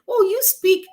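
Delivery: high, loud, put-on voice of the locals; playfully imitating the locals' amazed compliment; mock impressed local. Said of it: "they said".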